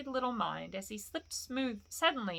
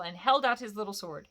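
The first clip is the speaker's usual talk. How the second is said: usual speaking voice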